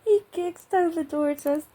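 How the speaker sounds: telling it through laughter; laughing. Real, not acted. Still laughing from the joke.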